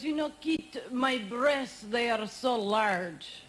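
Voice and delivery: mock sexy French accent, slow and low at the end. Imitating Sylvie bragging, in a French accent. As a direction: purr it in a put-on French accent. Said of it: "She has such a sexy accent".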